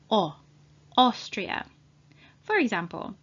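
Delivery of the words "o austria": "O" alone, then Austria starting on that sound. Shown as a demonstration. Teacher links O to Austria, AU said as "O". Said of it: "they are pronounced as".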